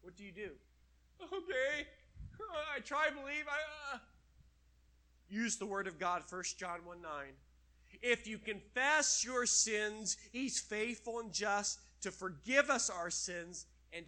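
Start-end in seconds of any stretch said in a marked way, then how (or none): 1.2-4.0 high, unsure stammering reply; flustered stammer. Acted. Sinner caught out by the accusing voice, high and stammering. 8.0-14.0 slow, loud scripture reading; preaching scripture. Acted. Preacher reading First John to the congregation, slow and loud.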